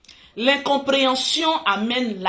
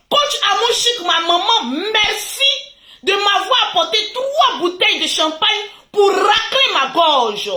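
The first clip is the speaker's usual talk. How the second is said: quoting the son; 9 semitones higher, 5 dB louder than usual. Retold or imitated. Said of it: "je cite".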